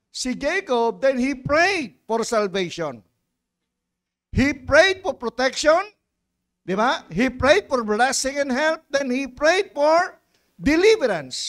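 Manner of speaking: loud, slow emphatic preaching; rising preacher's cadence. Acted. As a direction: drive each prayed home from the pulpit. Preaching at full force.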